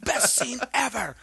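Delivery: high, loud, laughing disbelief; amazed reaction. Retold or imitated. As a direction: burst out in giddy amazement at the TV. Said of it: "see my reaction of".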